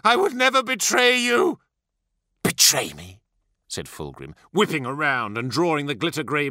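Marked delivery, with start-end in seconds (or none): 0.0-1.6 high, loud protestation of loyalty; desperate begging. A narrator's reading. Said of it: "he begged". 2.4-3.2 low, incredulous question; menacing echo. A narrator's reading. Primarch echoes plea with menace.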